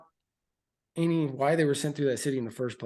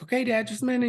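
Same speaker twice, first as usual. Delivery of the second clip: put-on child's reply; 11 semitones higher, faster than usual. Son answers father, voiced higher.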